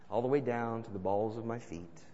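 ordinary talk

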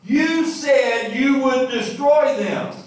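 Jonah's furious protest against God; furious complaint. Acted. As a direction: confront God, indignant. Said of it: "He was furious".

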